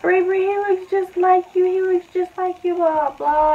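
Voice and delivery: high, eager voice of a proud mom; retelling mom's high-pitched gushing; excited mom. Retold or imitated. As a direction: gush over the baby like her mom did. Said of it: "she's like".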